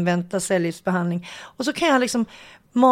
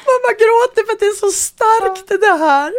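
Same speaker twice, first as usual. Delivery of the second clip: laughing; 12 semitones higher, 6 dB louder than usual. Real, not acted. Mom retells crying at race.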